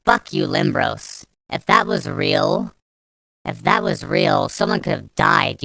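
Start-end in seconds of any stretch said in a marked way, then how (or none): none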